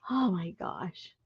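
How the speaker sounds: soft breathy oh my gosh; whispered. Real, not acted. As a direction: whisper it to yourself in awe. Hushed aside after excitement.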